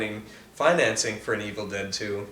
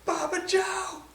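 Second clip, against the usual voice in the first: mock hillbilly call; 4 dB quieter, slower than usual. Hillbilly calling for missing Bobby Joe, mock high holler. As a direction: call out a name like a hillbilly, pitched way up. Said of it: "If I'm gonna be a hillbilly".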